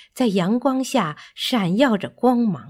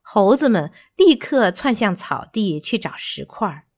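usual speaking voice

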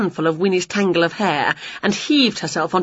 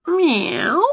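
high rising meow; a cat asking; questioning meow; 10 semitones higher than usual. A narrator's reading. Wilbur asking Winnie, high questioning meow. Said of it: "asked Wilbur".

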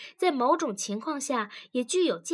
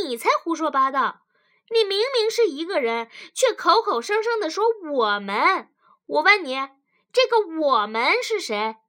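loud, higher, angry wife; indignant retort; 6 semitones higher, 8 dB louder than usual. A narrator's reading. Wife disputes husband's word "we", indignant and loud. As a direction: fire back loudly, voice pitched up. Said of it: "女子愤愤地反驳".

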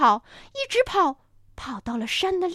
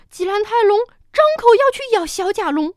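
usual speaking voice

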